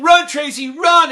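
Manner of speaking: loud high shouting cheer for a runner. Retold or imitated. Crowd in the bleachers urging Tracy to run, shouting. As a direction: yell it from the bleachers.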